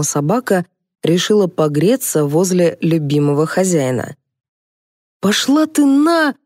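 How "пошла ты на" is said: high, drawn-out cry of outrage; mild acted yell. A narrator's reading. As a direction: yell it as a storyteller would, pitched up and slow. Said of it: "завопил Аркашка".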